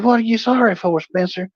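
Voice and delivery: put-on girlfriend's mother questioning the drunk caller; puzzled mother. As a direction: ask like a confused mom. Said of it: "she's like".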